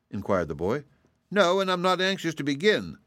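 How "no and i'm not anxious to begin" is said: much higher kitten voice; Eureka. A narrator's reading. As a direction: tart little cat, pitched way up. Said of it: "said Eureka".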